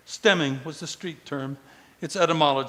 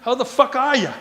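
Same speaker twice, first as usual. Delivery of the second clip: loud, high Boston greeting; loud Boston accent; 7 semitones higher, 6 dB louder than usual. Acted. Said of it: "a loud voice saying in a Boston accent".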